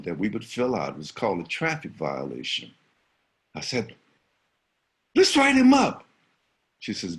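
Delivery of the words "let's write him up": high, loud plea; eager insistence. Retold or imitated. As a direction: beg the principal to act. Boy insists to principal.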